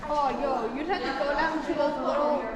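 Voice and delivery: ordinary delivery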